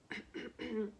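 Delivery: prisoner clears his throat quietly; a soft throat clear before speaking. Acted. Prisoner clearing his throat before answering. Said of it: "The prisoner cleared his throat".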